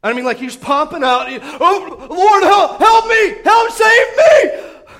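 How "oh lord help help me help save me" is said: shouted higher, slowing and stretching out; desperate cry for help. Acted. Peter sinking in the water, shouting to the Lord for rescue. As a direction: yell for help as you go under.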